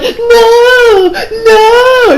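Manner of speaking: high squealing cry of no; a damsel shrieking in fake panic; mock scream. Women cry out at the villain.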